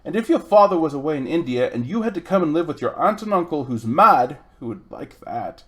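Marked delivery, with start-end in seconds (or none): 0.1-4.4 put-on louder, upset boy pouring it out; wound-up boy, louder. Upset boy tells Polly about his family, louder. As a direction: let it all tumble out, louder. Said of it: "too wound up to take any notice".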